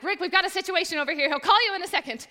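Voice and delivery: fast flustered phone excuse; flustered rush. Retold or imitated. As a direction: blurt it out in a panic.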